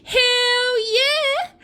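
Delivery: very high, hammed-up squeal; very excited. Nicole accepting the free holiday, hammed-up excited squeal. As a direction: shriek it with mock adrenaline. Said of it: "I was very excited and my adrenaline was right up".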